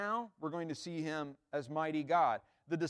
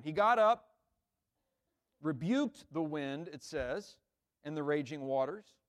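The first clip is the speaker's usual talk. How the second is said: usual speaking voice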